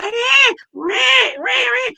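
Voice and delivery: put-on high squealing baby wail; high-pitched mock infant cry. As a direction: imitate a newborn crying. Baby crying voiced in story.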